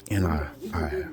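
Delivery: normal talking voice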